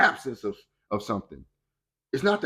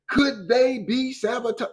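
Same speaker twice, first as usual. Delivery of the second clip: mock doubting; 8 semitones higher, slower than usual. Mocking the public's doubting question.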